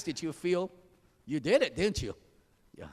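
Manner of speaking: ordinary talk, nothing marked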